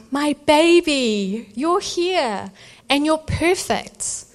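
tender wonder. Retold or imitated. Mother receiving newborn.

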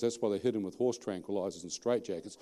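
ordinary talk

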